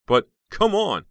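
high, loud protest. Acted. Heather protests in disbelief.

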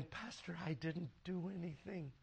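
hushed, very soft imitation of a crying wife; barely audible, wounded protest; retold tearful plea. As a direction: murmur it helplessly, as if through tears. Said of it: "she's crying, going".

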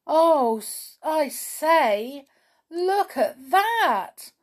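high, loud cry of dismay; storybook dismay, pitched well up. A narrator's reading. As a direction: exclaim in alarm, drawing the words out. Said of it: "said Binky in dismay".

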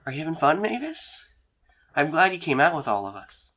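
a hint of a friendly companion; friendly woman character. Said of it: "and she goes".

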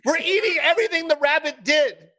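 high-pitched appalled comic rant; mock outrage. Comic defending rabbit against its cooks, mock outrage. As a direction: protest high, hamming up the horror.

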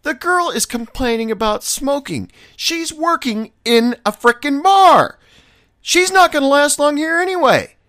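loud, high, indignant outburst; heated retort. Retold or imitated. Bartender snapping back at his boss about the coworker, loud and indignant. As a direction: fire back at the boss, exasperated. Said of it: "And I was like".

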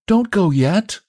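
high plea from the dark; lilting young woman's voice; soft-voiced girl. A narrator's reading. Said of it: "soft and low".

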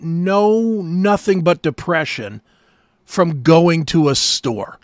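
normal talking voice

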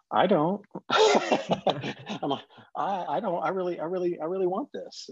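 high whiny begging with a laugh; mock pleading. Begging Tammy to do the reunion, high whiny plea. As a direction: whine like a kid begging.

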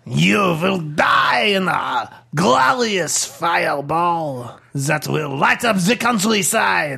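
grandiose French villain threat; French accent. Acted. Bomb threatening the two who came to defuse it, grandiose French accent. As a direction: declaim the threat in thick mock French. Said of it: "It's got eyes and a French accent".

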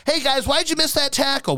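high, goofy reporter voice; dopey reporter. Retold or imitated. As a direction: ask like a clueless fat reporter. Dopey reporter asking players.

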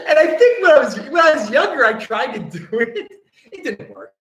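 high-pitched, helpless laughter through the words; real giggling, voice pushed way up; laughing. Real, not acted. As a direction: confess it while cracking up. Laughing at his own youthful attempt.

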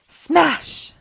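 high, loud storybook exclamation; dramatic crash. A narrator's reading. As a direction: hit it like a sudden crash. Story's crash as present breaks.

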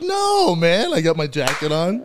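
high, loud retold protest; loud, high-voiced "no way" objection; mock protest. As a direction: pitch it way up in comic indignation. Retells protesting to his buddy.

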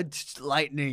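ordinary talk

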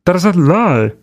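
high-pitched mock Kermit Cajun accent; Rodney Howard-Browne. Host demonstrating the preacher's accent, mock Kermit Cajun. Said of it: "Kermit Cajun".

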